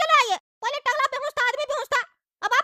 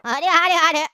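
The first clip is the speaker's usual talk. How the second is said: shouted reply from afar; calling out; 7 semitones lower, 6 dB louder than usual. Acted. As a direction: call back loudly that you're coming. Calling from another room.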